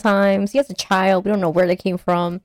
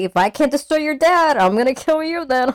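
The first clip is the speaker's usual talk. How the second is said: higher, sneering villain threat; mock villain threat; 7 semitones higher than usual. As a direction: spit it out like a spiteful villain. Villain Destroyer voiced.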